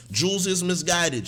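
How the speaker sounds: ordinary delivery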